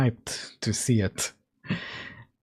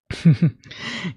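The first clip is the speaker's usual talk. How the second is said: a burst of high, loud embarrassed laughter; 7 semitones higher, 5 dB louder than usual. Real, not acted. Apologizing to viewers for coughing, a sheepish laugh.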